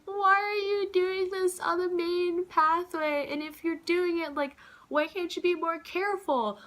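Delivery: high, loud, indignant re-enactment; angry scolding. Retold or imitated. To the smoker who burnt her on the main pathway, high angry scolding. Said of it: "angry because it hurt. And I was just like".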